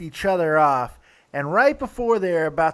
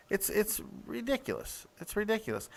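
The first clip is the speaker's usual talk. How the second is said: ordinary delivery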